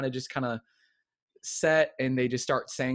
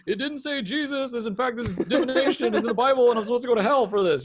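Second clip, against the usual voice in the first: high, loud, scandalized protest; mock religious outrage; 11 semitones higher, 7 dB louder than usual. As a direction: rail like a panicked conscience. Own religious conscience protesting tarot.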